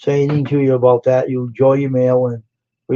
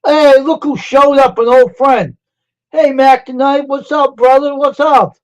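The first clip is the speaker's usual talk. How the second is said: excited greeting; 11 semitones higher, 4 dB louder than usual. Real, not acted. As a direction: call out delightedly as a friend shows up. Greets an old friend arriving.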